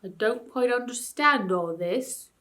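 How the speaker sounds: slow, puzzled bear voice. Acted. Bear slowly puzzling over the ape's words. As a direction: think it out slowly, a dim bear. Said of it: "slow, puzzled voice".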